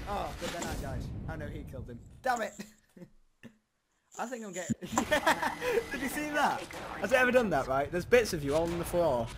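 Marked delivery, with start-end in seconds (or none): 3.0-6.6 high-pitched, softer laughing speech. Real, not acted. Player amused by his own death, laughing. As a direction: giggle through the words.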